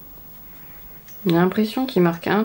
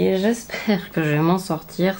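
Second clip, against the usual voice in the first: ordinary talk, nothing marked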